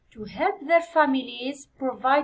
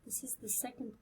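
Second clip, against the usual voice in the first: whisper; 20 dB quieter, much faster than usual. Real, not acted. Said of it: "whispered aside to self".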